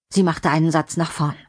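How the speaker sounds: ordinary delivery, nothing marked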